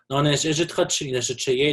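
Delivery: normal talking voice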